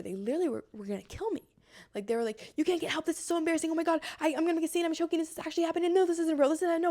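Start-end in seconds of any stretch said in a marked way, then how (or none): 2.6-6.9 high, breakneck mimicry of anxious thoughts; panicked inner voices. Choker's thoughts trying to gaslight them out of getting help, panicked. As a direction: run on in a raised, frantic mental chatter. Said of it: "like, taking over".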